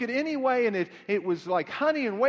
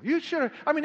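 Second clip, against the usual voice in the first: ordinary talk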